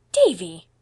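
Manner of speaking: loud, falling exclamation of the name; shocked reproof. A narrator's reading. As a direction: cut the child off sharply. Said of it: "convinced by Anne's tone".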